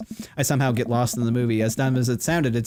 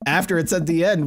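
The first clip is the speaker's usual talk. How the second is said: ordinary talk, nothing marked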